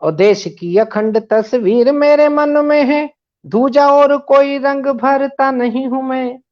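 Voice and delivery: declaimed verse with repeated lines; poem recitation. Acted. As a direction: recite it like a stage poet. Recites another stanza.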